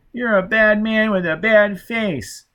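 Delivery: put-on high, slow crying child voice; frightened child. A narrator's reading. Shriveled child crying at the intruder, high and slow. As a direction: whimper it like a scared little boy. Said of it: "The child began to cry".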